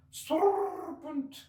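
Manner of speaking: shrill, very high shriek of a frantic bird; screaming pigeon. Acted. Pigeon screaming at Alice in alarm. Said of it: "screamed the pigeon".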